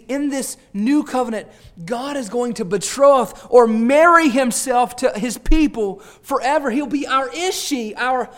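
fervent preaching. Acted. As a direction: lift the congregation with it. Preaching at full force.

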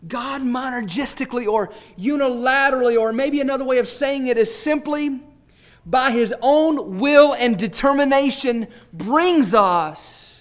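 fervent preaching. Acted. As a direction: lift the congregation with it. Preaching at full force.

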